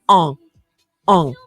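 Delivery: on said as a French nasal vowel; nasal on sound. Shown as a demonstration. Teacher demonstrates the nasal on for the class. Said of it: "Como si tuviesen una papa en la boca".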